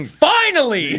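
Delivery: high gleeful cry with a laugh; mock delight. Homeowner hearing glass break, delighted. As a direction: greet it like a long-awaited gift. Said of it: "all you hear is".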